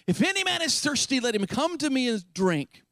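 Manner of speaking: calling out high across a crowd, slowed; cried out loud. Acted. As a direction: pitch it high and call it out to the crowd. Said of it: "cried out in a loud voice".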